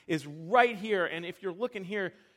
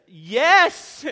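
loud, high emphatic yes; 6 semitones higher, 15 dB louder than usual. Shown as a demonstration. Dad showing his son's emphatic yes, loud and high. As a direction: bark out a loud eager yes. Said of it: "the second is the emphatic yes".

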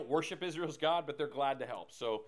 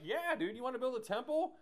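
imitating a chummy dude, pitched up high; high-voiced mock dude making a friendly trade; easygoing old buddy; 7 semitones higher than usual. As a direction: pitch it high, like a pal offering a deal. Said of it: "he's the guy that's like".

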